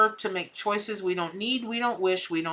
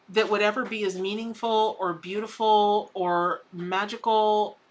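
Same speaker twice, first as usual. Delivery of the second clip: normal talking voice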